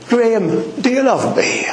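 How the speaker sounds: high tender voice of the Lord; the Lord asking. Acted. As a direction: ask Graham tenderly as the Lord. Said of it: "if the Lord was to ask you".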